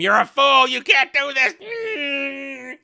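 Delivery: high, loud imitation of a screaming woman; mock yelling. Galadriel to Adar as she's dragged away, mock screaming. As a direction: yell it hammed-up, dragging out the end. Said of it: "as she yells at him".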